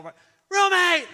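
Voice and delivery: high, loud shout across the room; yelled greeting. Retold or imitated. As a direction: holler it out as if spotting an old friend. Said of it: "this woman I hear yell".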